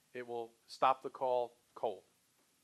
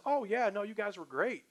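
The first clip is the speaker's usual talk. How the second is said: higher-pitched mock customer praise; pleased customer; 7 semitones higher than usual. Past customer reassuring the salesperson, pleased. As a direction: lift the voice as a happy client.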